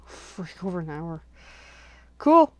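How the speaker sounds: ordinary talk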